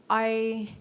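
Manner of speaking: ordinary talk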